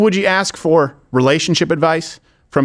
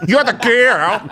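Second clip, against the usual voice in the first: childish mock taunt; 6 semitones higher, slower than usual. Skit playground taunt.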